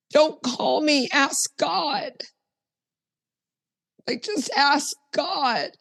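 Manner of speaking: high-pitched mimicked boyfriend; imitating boyfriend. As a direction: imitate him, pitched well up, handing off the question. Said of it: "I'm talking like my boyfriend".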